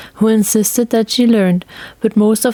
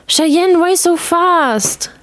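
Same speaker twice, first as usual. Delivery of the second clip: put-on boy calling out; 9 semitones higher, much slower than usual. A narrator's reading. Boy calls after girl.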